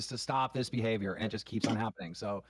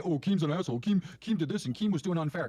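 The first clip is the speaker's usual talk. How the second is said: lightly voiced whiny critic, a bit louder; mocking complainer; 5 semitones higher, 3 dB louder than usual. Host mocking fans who blame him, light sarcastic imitation. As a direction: mimic the fans blaming him, half-hearted sneer. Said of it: "Don't try to say".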